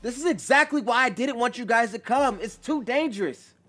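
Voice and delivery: high, loud rebuke; heated shouting; frustrated yell. A narrator's reading. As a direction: yell at friends in exasperation. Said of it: "tighter as he yells".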